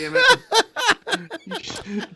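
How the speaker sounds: very high, slow laughing squeal; laughing shriek. Real, not acted. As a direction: stretch it out, helpless with laughter.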